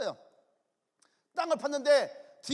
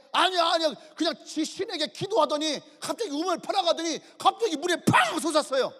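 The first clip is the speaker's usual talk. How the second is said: loud, high, excited retelling; acted breathless-news excitement; agitated report; 6 semitones higher, 6 dB louder than usual. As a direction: blurt out the incredible thing you just saw. Spy excitedly reports the gushing well.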